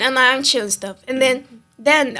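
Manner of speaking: ordinary delivery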